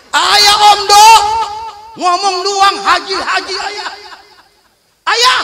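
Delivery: high, loud snapping wife; wife snapping at husband's empty promise; mock angry wife. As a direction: scold him like a fierce wife.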